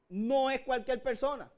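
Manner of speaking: ordinary talk, nothing marked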